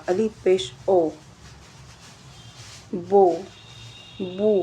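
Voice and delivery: بو with a firm o; vowel demo. Shown as a demonstration. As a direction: drill the o of پیش in بو. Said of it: "او کا sound دیتا ہے".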